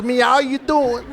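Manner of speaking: put-on cat with an Italian Brooklyn accent. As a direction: meow it like a Brooklyn Italian tough guy. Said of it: "Frankie is Italian from Brooklyn".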